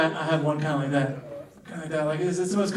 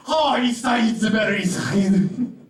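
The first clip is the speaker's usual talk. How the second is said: shouted comic Arabic twin voice; Arabic twins impression; 2 semitones higher, 3 dB louder, slower than usual. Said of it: "two Arabic conjoined twins".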